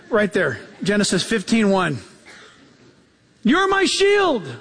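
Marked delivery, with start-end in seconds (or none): 3.4-4.5 high, plaintive mock prayer like a kid tattling; hammed-up complaining child crying to God; whiny child praying. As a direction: whine it up to God like a picked-on child.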